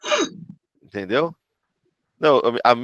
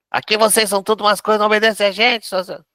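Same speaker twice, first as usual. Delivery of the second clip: high, rapid-fire mock scolding; exasperated parent telling off the kids; 8 semitones higher, 3 dB louder, faster than usual. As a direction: rattle off a parent's complaint, high-pitched. Acted parent scolding the children.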